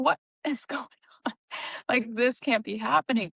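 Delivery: breathless, high whispered disbelief with a nervous laugh; patient reliving the moment the doctor gave the news, whispering in shock; stunned whisper. Retold or imitated. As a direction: whisper it like the floor just dropped out. Shocked reaction to diagnosis, retold.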